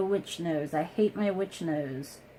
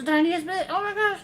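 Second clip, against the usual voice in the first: real shocked squeal, much higher and louder; surprised squeal; 11 semitones higher, 7 dB louder, faster than usual. Real, not acted. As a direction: jump up in pitch at the surprise. Too much product squeezed out.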